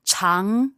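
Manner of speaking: "chang" gliding upward; second tone. Shown as a demonstration. Teacher correcting the learner, "chang" just going up. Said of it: "second tone".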